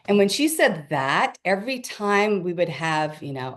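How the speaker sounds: ordinary delivery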